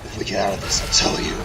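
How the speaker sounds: low, whispered gruff soldier voice; Solid Snake impression. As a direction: growl it low like a tired special-ops soldier. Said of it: "horrible Solid Snake impression".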